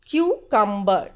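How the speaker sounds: "cucumber" as KYOO, kum-buh; correct pronunciation. Shown as a demonstration. Teacher repeating for learners, correct "cucumber" as KYOO, kum-buh. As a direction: say "cucumber" with a clearly separated "kyoo" at the front. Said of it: "The correct pronunciation is".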